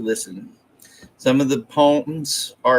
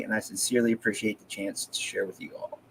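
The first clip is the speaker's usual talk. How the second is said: whispering; 5 semitones lower, 11 dB quieter, faster than usual. Real, not acted. Sincere thanks before reading.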